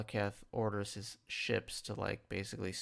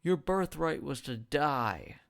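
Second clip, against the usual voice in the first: slow, loud, raised stern shout; angry yell; 5 semitones higher, 5 dB louder than usual. Retold or imitated. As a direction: bellow it down at a disobedient son. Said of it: "screams at him".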